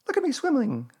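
high, proud little squeak; Roo. A narrator's reading. Little one still in the water, bubbling proudly. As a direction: show off proudly in a tiny high voice. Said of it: "Roo, still bubbling proudly".

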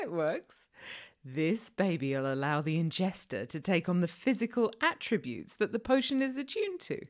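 ordinary delivery

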